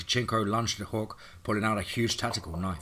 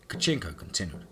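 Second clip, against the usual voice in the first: plain reading voice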